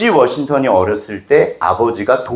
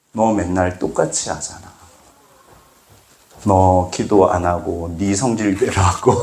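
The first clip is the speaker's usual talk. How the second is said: God's reproving reply; 5 semitones lower, 3 dB quieter than usual. Acted. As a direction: answer the complaint lower and unhurried. God answers the believer's complaint.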